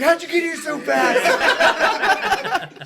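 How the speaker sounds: high, loud, very slow laughing mock amazement; imitating a bewildered friend. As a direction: stretch out his disbelief while laughing. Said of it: "and he's like".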